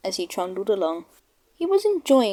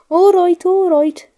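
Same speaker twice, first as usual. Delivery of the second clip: loud, higher grudging give-in; grumbling; 9 semitones higher, 9 dB louder than usual. Acted. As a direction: give in with bad grace. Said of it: "grumbled James".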